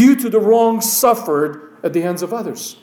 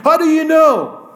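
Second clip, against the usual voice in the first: high, loud, disbelieving question; preacher mocking the brothers' claim, disbelieving and loud; incredulous challenge; 8 semitones higher, 4 dB louder than usual. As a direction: throw the question at them in disbelief.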